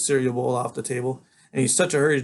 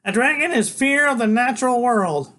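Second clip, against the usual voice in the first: put-on know-it-all boy; 11 semitones higher, 5 dB louder than usual. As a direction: lecture like a tiny professor. Freak voiced.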